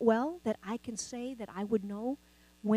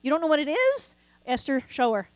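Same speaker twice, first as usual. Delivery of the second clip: ordinary talk, nothing marked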